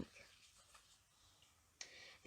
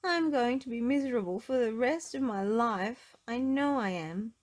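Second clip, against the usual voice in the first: despairing; 6 semitones higher, 5 dB louder than usual. Acted. Miss Giggles despairing.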